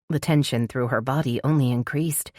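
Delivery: plain reading voice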